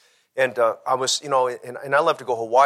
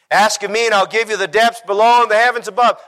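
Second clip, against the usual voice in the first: much higher and louder, ringing out; booming voice of God; 11 semitones higher, 12 dB louder than usual. Acted. Preacher quoting God to the congregation, booming. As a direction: proclaim it as God speaking.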